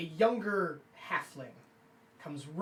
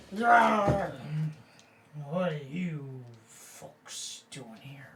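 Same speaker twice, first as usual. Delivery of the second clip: put-on drunken dwarf; 5 semitones lower, much slower than usual.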